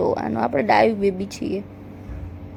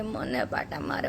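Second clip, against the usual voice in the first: fading whisper; 12 semitones lower, 11 dB quieter than usual. Shown as a demonstration. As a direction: drop to a whisper on મને પાટા મારે. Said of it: "repeated as whispered fade". (ધીમા અવાજે ફફડાટ)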